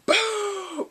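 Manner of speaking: a high, loud boom; imitated crash. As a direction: burst in with the sound effect.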